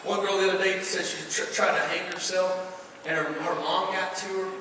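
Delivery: usual speaking voice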